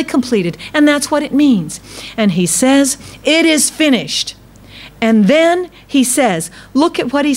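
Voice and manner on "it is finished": high, lifted cry of completion; voicing Jesus. Preacher voices Jesus declaring the work done, high. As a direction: raise the pitch and ring it out as triumph.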